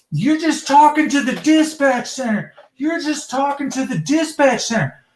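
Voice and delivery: high-pitched, insistent imitation; mock dispatcher. Dispatcher fending off a caller wanting the chief, mock dispatcher. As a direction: repeat it as a put-on, high-voiced dispatcher. Mimics dispatcher brushing him off.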